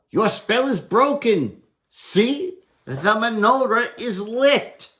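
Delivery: high, loud gloating victory; triumphant Herschel. Acted. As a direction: crow that the spell is broken.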